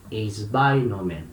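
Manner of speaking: "Esbainomen" bem devagar, sílaba por sílaba, tônica em "bai"; pronúncia grega lenta. Shown as a demonstration. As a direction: soletre "Esbainomen" lentamente, tom baixo. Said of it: "Então, vai virar". (professor dita "Esbainomen" devagar para os alunos copiarem)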